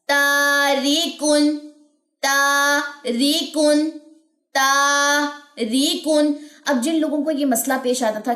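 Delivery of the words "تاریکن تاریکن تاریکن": تاریکن with the long aa stretched very slowly and dissolving; slow recitation drill. Shown as a demonstration. Teacher recites slowly for students to repeat. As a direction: chant تاریکن slowly, aa melting into air. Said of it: "جوف دہن میں یہ غائب ہونی چاہیے".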